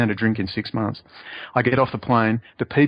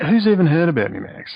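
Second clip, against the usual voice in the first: ordinary delivery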